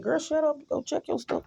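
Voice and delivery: the Lord's voice. Retold or imitated. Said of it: "He, he said".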